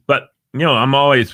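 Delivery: normal talking voice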